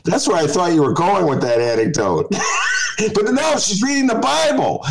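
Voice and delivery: high-pitched, laughing through the words; real amused laughter. Real, not acted. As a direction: crack up at your own joke. Host cracks up at own joke.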